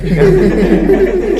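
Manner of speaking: high, loud burst of laughter. Real, not acted. Class joke lands, loud high laughter.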